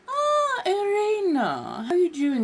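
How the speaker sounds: gushing, theatrical hello; delighted greeting. Real, not acted. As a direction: greet a dear friend with a big swooping cry. Greets an arriving viewer by name.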